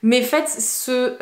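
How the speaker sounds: normal talking voice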